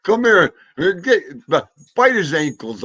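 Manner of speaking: high and loud, egging the dog on; excited calling out. Real, not acted. Owner urging his dog to come and bite ankles, loud and high-pitched. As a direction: rile the dog up, call it over loud.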